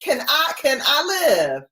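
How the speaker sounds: high, fast pleading whine; exasperated mock plea. The owner pleading with her dog, exasperated mock whine. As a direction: beg for privacy with mock outrage. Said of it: "I was like".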